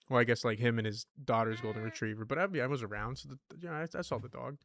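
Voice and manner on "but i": ordinary delivery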